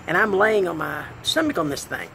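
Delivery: ordinary delivery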